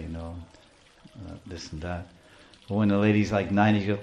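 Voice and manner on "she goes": ordinary talk, nothing marked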